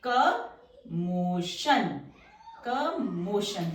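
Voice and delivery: Commotion stretched very slow and low; drawn-out word. Shown as a demonstration. Teacher slowly sounding commotion while drawing the strokes. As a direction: drag commotion out syllable by syllable.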